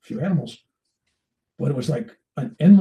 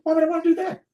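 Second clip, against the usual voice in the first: much higher, disbelieving whine; incredulous protest; 14 semitones higher, faster than usual. Retold or imitated. Bill protesting to the guru, high and incredulous. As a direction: squeak out the disbelief as if the deal is absurd.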